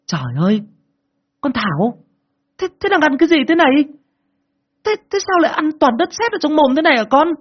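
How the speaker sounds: very high, loud panicked mother cry; mother shrieking. A narrator's reading. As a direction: shriek in alarm at the child, fast. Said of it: "mẹ hét ầm lên".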